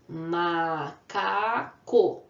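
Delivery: "Macaco" very slow, split into ma, ca, co; teacher sounding out "Macaco" in three beats; syllable by syllable. Shown as a demonstration. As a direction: stretch each syllable of "Macaco" with gaps between. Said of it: "quando eu faço a separação".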